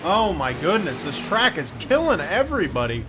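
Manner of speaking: loud exclamation at the carnage; shocked commentator; excited dismay. Real, not acted. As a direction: react loudly to another crash. Trucks crashing, commentator reacts.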